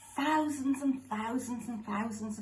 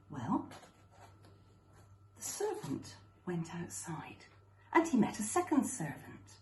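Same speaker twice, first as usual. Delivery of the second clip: ordinary delivery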